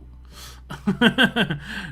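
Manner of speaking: high, falling burst of laughter; amused laugh. Real, not acted. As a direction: laugh it off out loud. Laughing after saying no.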